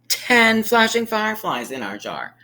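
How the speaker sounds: ordinary talk, nothing marked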